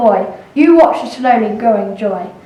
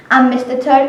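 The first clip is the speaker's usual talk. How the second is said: ordinary delivery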